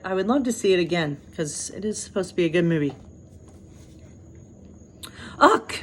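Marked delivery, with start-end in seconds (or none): 5.4-5.7 high exasperated groan; a frustrated teen's groan; complaining ugh. A narrator's reading. Merida groaning at her unfinished brooch. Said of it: "Merida complained".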